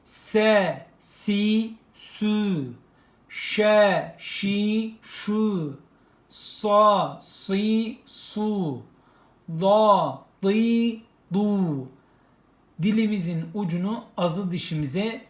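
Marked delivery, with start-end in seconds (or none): 0.0-11.9 "Se, si, sü" sounded out high and crisp; teacher sounding syllables aloud; syllable drill. Shown as a demonstration. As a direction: drill "Sa, si, su" and "Da, di, du" with thick consonants. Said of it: "Keskin harfimiz Z".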